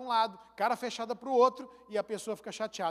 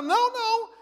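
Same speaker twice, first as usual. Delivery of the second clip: mock patient; 11 semitones higher, 8 dB louder than usual. Family member answering.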